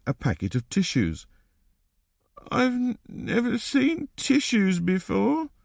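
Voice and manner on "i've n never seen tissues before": put-on high, slow, stammering child; high-pitched, halting small boy; tearful little boy. A narrator's reading. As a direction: stumble over words like a crying child. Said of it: "said the boy".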